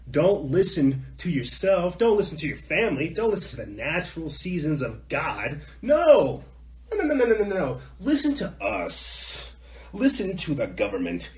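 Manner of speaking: high, loud hammed-up orders; mock authoritarian command. Host mimicking officials to the public, bossy and insistent. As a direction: order them about, pouncing on each no.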